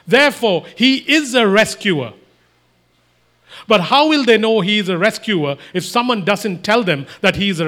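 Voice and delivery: loud, forceful sermon delivery; emphatic preaching. Real, not acted. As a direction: press each point home to the congregation.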